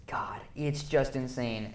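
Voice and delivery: awed whisper. Real, not acted. Film critic whispering in awe at the spectacle. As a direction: breathe it in wonder.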